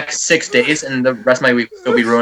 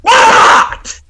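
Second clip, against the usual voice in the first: explosive, high screamed answer; loudest possible shout; 8 dB louder than usual. Retold or imitated. Son woken by mom at seven, monster-freak shout. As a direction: erupt in a huge yell. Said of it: "then just the loudest thing you've ever heard".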